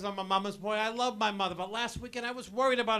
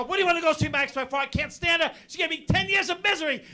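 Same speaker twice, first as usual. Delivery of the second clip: mock outrage; 6 semitones higher, 5 dB louder, faster than usual. Son's outraged retort.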